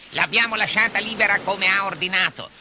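pirate henchman. Acted. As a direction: assure the boss in a high sidekick voice. Henchman reports to captain.

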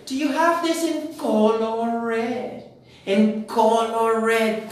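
slow, high-pitched "color red" with Spanish o sounds; imitated learner mistake. Teacher quoting a common learner error, slow mock mispronunciation of "color". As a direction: drag out "color" as Spanish co-LOR, mimicking learners. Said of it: "mucha gente comete este error y dice".

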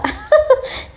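laugh. Real, not acted. Laughs about her son.

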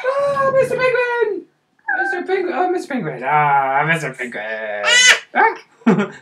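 high, delighted squeal; excited. Real, not acted. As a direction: greet the toy with delight. Playful greeting of toy.

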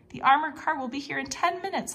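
exclaimed. A narrator's reading. Geronimo realizing the armored car is coming, exclaiming high. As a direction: blurt it out, suddenly worried about time. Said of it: "I exclaimed".

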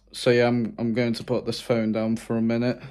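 ordinary delivery

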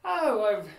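normal talking voice

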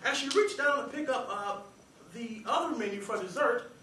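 ordinary talk

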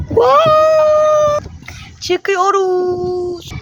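high, thrilled wow cry; excited shout. Real, not acted. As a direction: yell it out with real delight. Shouting on a swing ride.

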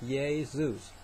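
"Jesus" as YAY-zooz; old Italian-style pronunciation. Shown as a demonstration. Preacher reads out the old spelling, as still said in Italy, Italian pronunciation. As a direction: say "Jesus" with a Y in place of J and long oo. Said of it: "which is how they say it in Italy still".

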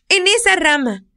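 put-on high, eager child calling out; little girl voice. Little girl calls the older woman about the cat, high child voice. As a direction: play a worried little girl.

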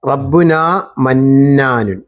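slow, drawn-out recitation of റബ്ബുന മന്നാനുൻ; slow teacher's model; slow model reading. Shown as a demonstration. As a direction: spell out each syllable slowly for the student. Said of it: "ഞാൻ പറയുന്നത് പോലെ പറഞ്ഞാൽ".

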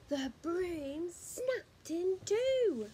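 usual speaking voice

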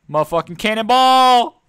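huge drawn-out yell; cannonball yell. Real, not acted. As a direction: scream it while jumping in. Jumping into water, shouting.

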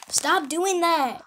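loud, slow, fed-up stop; exasperated shout. Real, not acted. Cousin yells at the vlogger to drop the voice, exasperated. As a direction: yell at him to quit it.

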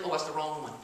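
ordinary talk, nothing marked

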